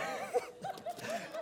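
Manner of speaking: high burst of laughter; real laugh. Real, not acted. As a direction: crack up at yourself. Laughs at own joke.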